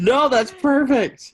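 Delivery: high, loud laughing praise. Real, not acted. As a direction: laugh through the words, delighted. Laughing delight at the impression.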